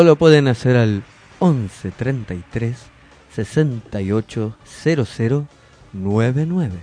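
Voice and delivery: low, soft seductive number read; sultry narrator. Shown as a demonstration. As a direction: drop the voice low and sensual. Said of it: "más despacito y con voz un poco más, eh, grave". (voz sensual, grave y suave)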